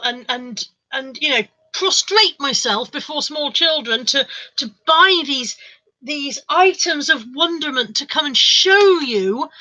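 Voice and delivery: slow, over-dramatic self-pity held with a straight face; milk every grand word, drawn out; mock martyr. Streamer listing sacrifices for the viewers' sake, mock martyr. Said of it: "struggling with the straight face".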